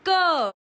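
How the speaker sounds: क said loud and high, ending on a clear अ; vowel ending demo; full क sound. Shown as a demonstration. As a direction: punch the अ in क. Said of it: "जब मैंने इसमें अ मिलाया तब ये बना".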